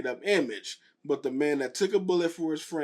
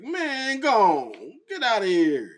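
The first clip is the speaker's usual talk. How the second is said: shooing away; 6 semitones higher, 5 dB louder, slower than usual. Pretend.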